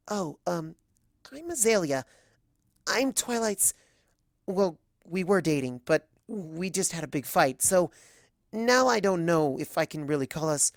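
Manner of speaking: a shy filly fumbling for words; nervous young mare. Acted. Azalea meeting Celestia, timid and unsure.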